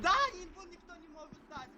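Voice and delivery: high, loud frustrated yell; snapping at teammates; angry shout. Real, not acted. Teammates argue over callouts.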